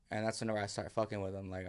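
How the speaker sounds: normal talking voice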